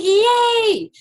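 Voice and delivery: a high, loud burst of joy; delighted cheer. Real, not acted. Traveller cheering when told she's on the flight, high and loud. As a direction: squeal it out like you just won.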